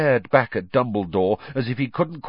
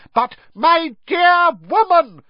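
slow, much higher, loud roar; 9 dB louder than usual. Acted. Fudge roaring over McGonagall as Minister. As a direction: roar her down pompously. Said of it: "roared Fudge".

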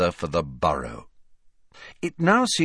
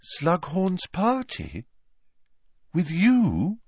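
slow, high astonished questions; surprised; 9 semitones higher, slower than usual. A narrator's reading. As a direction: draw it out in high-pitched disbelief. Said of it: "turned her protuberant eyes upon him in surprise".

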